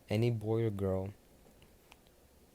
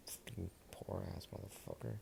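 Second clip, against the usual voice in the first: muttered insult; 13 dB quieter than usual. Acted. Discord muttering insult aside.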